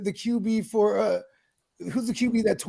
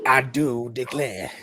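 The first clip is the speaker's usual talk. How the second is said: slow, lower Southern drawl; mock Southern drawl; 6 semitones lower, slower than usual. Mocking a Southern drawl to friends, slow and lower. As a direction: draw out I do declare in a Southern drawl. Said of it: "a pretty thick Southern drawl".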